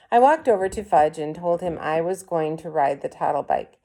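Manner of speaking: plain reading voice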